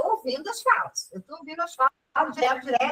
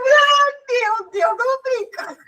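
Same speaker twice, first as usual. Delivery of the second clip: laughing disbelief; 10 semitones higher, slower than usual. Retold or imitated.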